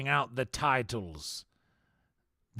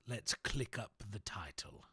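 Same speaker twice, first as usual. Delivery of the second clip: whisper; 8 dB quieter than usual. Pretend. Whispered aside.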